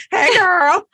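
high, cute bunny voice; bunny's voice. Pretend. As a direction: voice the bunny saying hi. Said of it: "So that's how my buddy talks".

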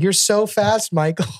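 retold admiring cry. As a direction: quote the gushing line, voice lifted. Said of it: "They're like".

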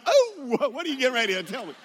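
high, startled "oh", then rushed; mock alarm. Pastor reacting to the clerk's confession, mock-alarmed. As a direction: act out comic shock, laughing.

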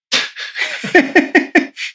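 high, breathless burst of laughter. Real, not acted. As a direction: cracks up at his own story, airy and high. Big laugh at story.

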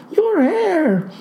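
high, hammed-up cry of horror; bellowed horror. A narrator's reading. Clerk repeats her horror at farmer's hair, bellowing. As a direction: wail it high in mock horror. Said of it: "she bellowed".

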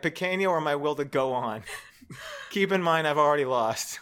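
mock-tragic letter read with bursts of laughter; laughing through a reading. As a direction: keep cracking up while reading the sob story. Reads message, laughing through.

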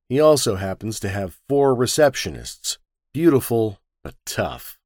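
usual speaking voice

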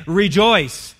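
second rejoice hammered home loud; loud emphatic rejoice. Shown as a demonstration. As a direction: belt out rejoice. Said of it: "I'll tell you again".

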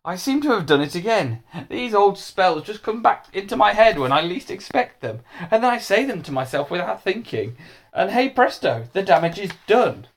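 high-pitched rueful explaining; flustered magical teacher. Acted. Mister Majika admits his spells slipped out, rueful.

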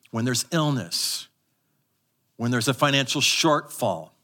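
ordinary delivery

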